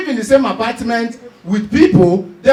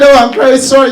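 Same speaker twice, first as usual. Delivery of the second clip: mock an over-emotional American apologizing; hammed-up distraught sorry; emotional apology; 3 semitones higher, 13 dB louder, faster than usual. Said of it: "Americans are very emotional".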